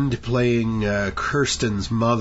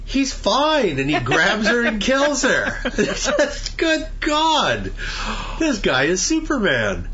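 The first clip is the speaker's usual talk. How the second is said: incredulous; 10 semitones higher than usual. Real, not acted. Incredulous retelling of movie scene.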